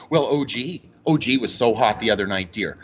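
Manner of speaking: low, lightly voiced sports-mad mom; a hint of his mother, doting; imitating mom. Son voicing his mom gushing about the Raptors. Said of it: "she'll be like".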